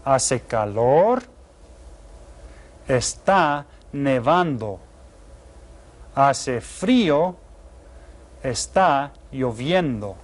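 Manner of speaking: slow, clear teacher reading of each phrase; slow key readout. Shown as a demonstration. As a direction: read the weather key slowly and clearly. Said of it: "Now here's the key".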